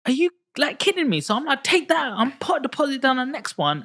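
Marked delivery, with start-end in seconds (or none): 0.1-1.2 much higher and louder, thrilled incredulity; retold excited disbelief. Retelling cheap lending to the host, high thrilled disbelief. 1.6-3.8 much higher and louder, giddy and eager; gleefully grab the chance in a high voice; retold excitement. Recalling rushing to buy the next property, giddy high voice. Retelling eager buying decision.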